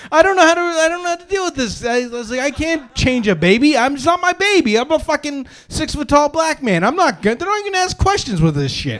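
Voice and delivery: high-pitched frantic protest; hammed-up freak-out, voice shooting way up; comic panic. Retelling his panic over the child.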